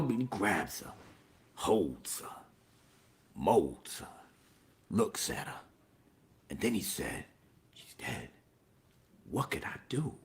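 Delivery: barely audible, low whisper; mock-tragic whisper; hushed mournful narration. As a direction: murmur it with long, grave pauses. Narrating a mournful death scene.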